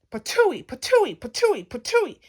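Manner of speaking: loud, high, punched spitting sounds; rapid-fire cartoon spitting; spitting noises. A narrator's reading. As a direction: spit each patooey out like a flying bolt. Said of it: "It spat bolt".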